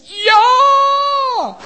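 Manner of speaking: long high held cry; hammed-up answering yell, stretched out; shouted yes. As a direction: belt it high and loud, holding the vowel. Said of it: "Maar mijn nieuwe mens".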